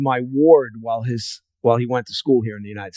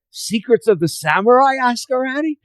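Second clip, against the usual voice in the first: much higher, star-struck question; awestruck disbelief; 12 semitones higher than usual. Retold or imitated. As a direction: geek out at meeting the author.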